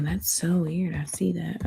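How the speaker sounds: soft whisper to herself. Real, not acted. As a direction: whisper it in surprise. Muttered reaction to cards.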